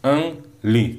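"Law, ng, li" as three separate beats; split into syllables. Shown as a demonstration. Teacher sounding out lovingly for students, "Law, ng, li" in beats. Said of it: "Again three syllables".

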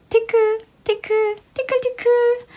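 very high teasing tickle voice; tickling germ voice. Acted. A tiny germ tickles inside the nose, very high.

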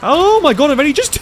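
loud, high frightened shout; real shaken yell, peaking the mic; scared horror game streamer. Real, not acted. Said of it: "I peaked my microphone".

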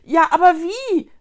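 loud, high exclaimed question; calling out. A narrator's reading. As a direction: cry out the question urgently. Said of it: "rief Vicky".

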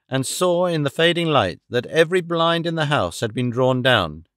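ordinary delivery

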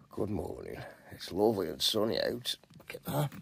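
soft, low, half-whispered; hushed morning voice. Real, not acted. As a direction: keep it down so nobody wakes. Early morning, others still asleep.

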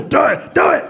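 quick, urging devil on the shoulder. Acted. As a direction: egg them on in a pushy devil voice.

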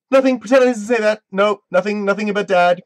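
flustered backpedal. Real, not acted. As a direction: take it back in a flustered panic. Frantically taking back a spoiler.

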